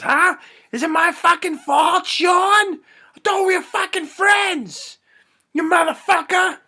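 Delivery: high, loud furious yelling; enraged shout. Acted. Damon pounding his psychiatrist on the floor, enraged shouting. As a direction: scream it at the man you are beating.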